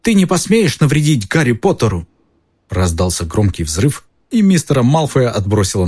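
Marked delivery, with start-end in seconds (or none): 0.0-2.1 shouting elf. A narrator's reading. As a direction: cry out to protect him. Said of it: "Но Добби крикнул".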